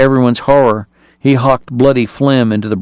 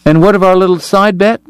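light, high-pitched teasing question; airy; 8 semitones higher than usual. A narrator's reading. Kate asking Greer about their bet, airy. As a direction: ask it airily, pitched up. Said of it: "Kate asked Greer airily".

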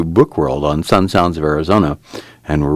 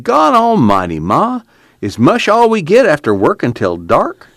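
much higher, louder whining complaint; a hungry son complains to his mother about supper; complaining; 11 semitones higher, 3 dB louder than usual. A narrator's reading. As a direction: whine it at mother. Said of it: "Al complained".

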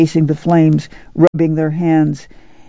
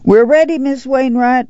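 calling out; 8 semitones higher than usual. A narrator's reading. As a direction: call out down the car. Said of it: "Ma called".

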